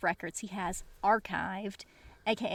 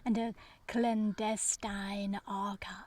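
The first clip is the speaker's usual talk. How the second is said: slow, breathy mock-mysterious whisper. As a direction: hush it like a spooky secret. Mock-mysterious listing of tropes.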